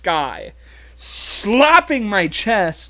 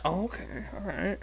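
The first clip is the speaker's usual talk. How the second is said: soft, high, unsure going-along; meek hesitant agreement; 4 semitones higher, 13 dB quieter than usual. Retold or imitated. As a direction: mumble it, caught off guard. Voicing hesitant reaction.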